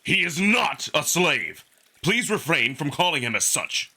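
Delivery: an angry wolf defending his companion; open anger. A narrator's reading. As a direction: let the anger out at full volume, slowly. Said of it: "not bothering to hide his anger anymore".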